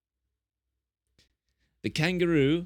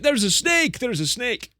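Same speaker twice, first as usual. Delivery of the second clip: panicked shout; 10 semitones higher than usual. Retold or imitated. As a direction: yell it like you've just spotted a snake.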